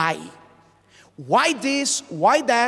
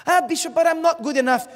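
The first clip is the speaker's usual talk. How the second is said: a quick, higher, lightly voiced doubter; doubting congregant; 8 semitones higher, 4 dB louder, faster than usual. Preacher voicing a doubtful congregant, quick and high. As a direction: toss it off fast like an unsure churchgoer.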